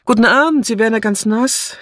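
high, brisk call to a passer-by; calling a greeting. A narrator's reading. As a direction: hail her through the rain. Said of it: "und rief". (munter herübergerufen)

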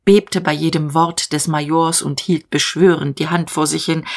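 ordinary delivery

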